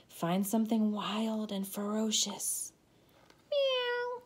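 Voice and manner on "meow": very high meow; kitten meow. Acted. Child playing kitty, high meow. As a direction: mew like a little kitty. Pretending to be a cat.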